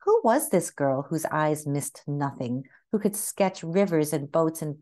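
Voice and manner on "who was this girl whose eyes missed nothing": ordinary delivery, nothing marked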